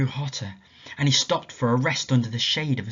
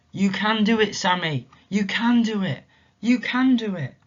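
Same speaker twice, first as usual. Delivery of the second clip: higher, coaxing voice; gentle encouragement; 9 semitones higher, 5 dB louder than usual. Acted. Mysterious voice urging tired Sammy, gently encouraging. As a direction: urge him on kindly.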